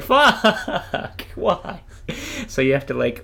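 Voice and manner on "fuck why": laughing groan. Retold or imitated. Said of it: "and you're like".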